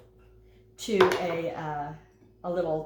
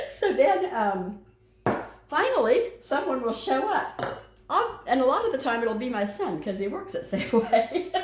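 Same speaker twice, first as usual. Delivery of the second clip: laughing; 6 semitones higher, 3 dB louder than usual. Real, not acted. Laughing about son at store.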